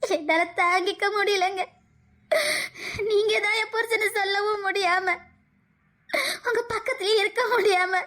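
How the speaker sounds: crying through the words, voice rising; tearful. Acted. Wife breaking down before her husband, crying. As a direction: plead through sobs, overwhelmed. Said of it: "நான் அழுறதே உங்களுக்காக தான்".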